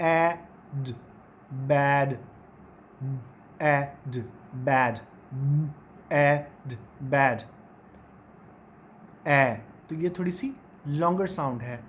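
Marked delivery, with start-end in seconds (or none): 0.1-7.4 एड and बैड alternated low and clear; modelled drill. Shown as a demonstration. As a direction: drill एड then बैड, lower pitch. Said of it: "किस तरह से pronounce करेंगे".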